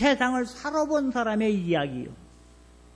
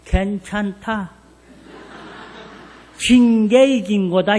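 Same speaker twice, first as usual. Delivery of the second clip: father's calm saying; 4 dB louder, slower than usual. Retold or imitated. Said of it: "우리 아버지가 이래는겨".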